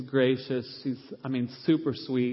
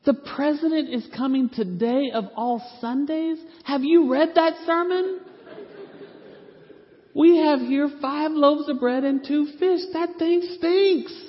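much higher, louder, slow exasperated pleading; exasperated prayer; 7 dB louder, slower than usual. Retold or imitated. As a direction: complain to God in comic despair. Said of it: "my conversation with the Lord goes something like this".

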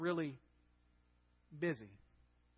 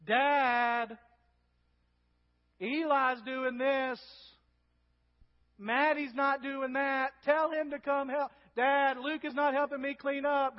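drawn-out, higher, louder whine to Dad; tattling sibling; 5 semitones higher, 5 dB louder, slower than usual. Acted. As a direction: tattle on a brother like an exasperated kid. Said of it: "that tone of voice between siblings".

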